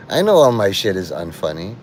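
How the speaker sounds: normal talking voice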